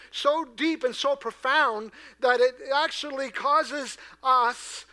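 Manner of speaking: loud, rising sermon build; preaching at full force. Acted. Pastor urging the congregation, preaching at full force. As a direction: drive the point home from the pulpit.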